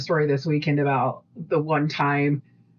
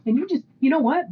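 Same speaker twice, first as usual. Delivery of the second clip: high-pitched bickering comeback; retold indignant self-defence; defiant retort; 13 semitones higher than usual. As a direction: argue back, voice pitched way up. Her retort in retold quarrel.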